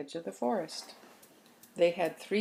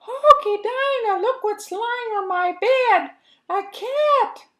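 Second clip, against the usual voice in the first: very high, much louder alarmed squeal; frightened squeak; 11 dB louder than usual. Acted. Baby Mouse spots the cat on his bed, frightened squeal.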